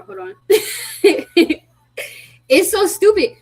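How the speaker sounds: giggling through the words; high giggly laugh. Real, not acted. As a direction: laugh at the glitch. Laughing at her frozen face.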